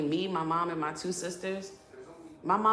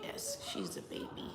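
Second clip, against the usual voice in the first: whisper; 9 semitones lower, 11 dB quieter than usual. Real, not acted. Telling the chat in a hushed aside, whispering.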